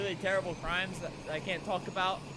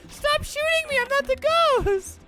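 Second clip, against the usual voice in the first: very high, loud imitation of a frantic player; mock screaming; 7 dB louder than usual. Mimicking a player pleading with the shooter, mock screaming. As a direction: squeal it as a mocking scream. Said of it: "just screaming at him".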